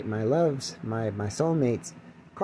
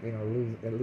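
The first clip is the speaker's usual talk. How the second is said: ordinary delivery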